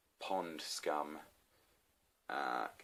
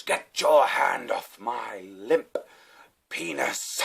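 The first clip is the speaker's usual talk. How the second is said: loud, slow theatrical indignation; imitated arrested diner; 4 semitones higher, 7 dB louder, much slower than usual. Diner touched by an officer, mock grand indignation. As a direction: declaim it in outrage, dropping on the last word. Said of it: "And he goes".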